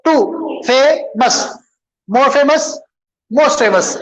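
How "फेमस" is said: Famous broken as "फे … मस"; a beat between फे and मस; split into syllables. Shown as a demonstration. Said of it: "how many syllables". (Famous को दो हिस्सों में तोड़कर)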